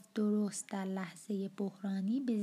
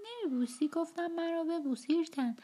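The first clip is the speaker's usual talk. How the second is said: put-on high, sharp drunken outburst at the child; a drunk father's sudden furious command; angry demand; 8 semitones higher than usual. Drunk father forcing child's kiss.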